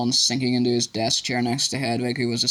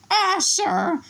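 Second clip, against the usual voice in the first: much higher gasping elf; gasped. A narrator's reading. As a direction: sussulto acuto da elfo. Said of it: "he gasped".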